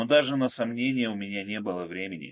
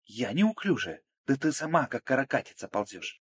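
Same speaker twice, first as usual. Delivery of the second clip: higher-pitched, offended comeback; indignant retort; 5 semitones higher than usual. Acted. Margo answering Irene's scolding, indignant retort. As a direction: fling the insult back. Women quarrelling while crawling under fire.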